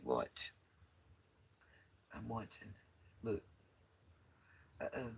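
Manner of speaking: hushed. Real, not acted. Vlogger watching from the car where they sleep, hushed. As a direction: keep the voice down, barely above a whisper. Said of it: "I don't know why I'm whispering".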